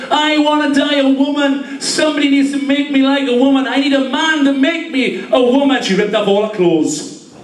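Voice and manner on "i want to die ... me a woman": mock desperate woman. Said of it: "She said".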